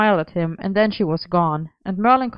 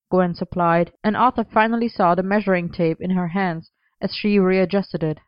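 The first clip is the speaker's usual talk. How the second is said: ordinary delivery, nothing marked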